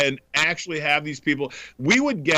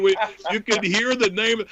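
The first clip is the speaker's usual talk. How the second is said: ordinary talk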